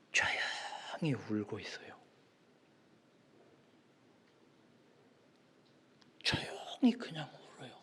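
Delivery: very soft, near-voiceless telling; hushed whisper. Retold or imitated. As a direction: whisper it to convey a lonely child's silent crying. Preacher voices the child's silent crying. (속삭이듯 아주 조용히)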